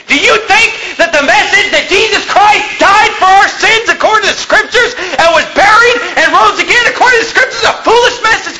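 high-pitched, fired-up preaching; preacher's heated, incredulous outburst; indignant challenge. Real, not acted. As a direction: hammer out the rhetorical question at a high pitch.

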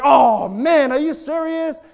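high-pitched acted exasperation; upset. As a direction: re-enact a dad's indignant outburst. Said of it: "I just got upset".

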